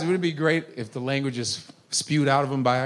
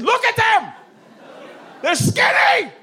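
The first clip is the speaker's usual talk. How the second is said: high shout. Retold or imitated. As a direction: yell it up high. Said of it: "I said".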